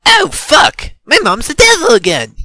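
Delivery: loud, high cry of alarm. A narrator's reading. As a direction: wail it out in panic. Cries out at devilish mother.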